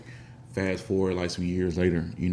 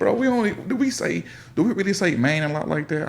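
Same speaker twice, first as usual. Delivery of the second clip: incredulous; 11 semitones higher than usual. Retold or imitated. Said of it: "I was like".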